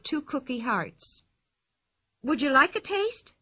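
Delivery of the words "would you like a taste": friendly Pippi. Acted. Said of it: "she asked".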